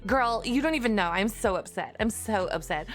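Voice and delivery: put-on Valley Girl whine about a broken gadget. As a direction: play up a dramatic Valley Girl. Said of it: "too bad for the Valley Girl team".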